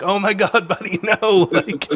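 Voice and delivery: high, loud, through laughter; laughing dismay. Retold or imitated. Uncle sees nephew opened the film, laughing dismay. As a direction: laugh in disbelief.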